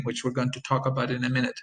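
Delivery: normal talking voice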